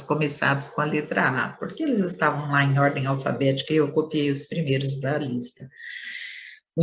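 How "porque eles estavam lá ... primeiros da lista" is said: ordinary talk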